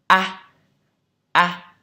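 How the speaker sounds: exaggerated wide-mouthed A; teacher's exaggerated A, mouth wide open. Shown as a demonstration. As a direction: drop the jaw wide and say A loud. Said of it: "mở ra rất là to như sau".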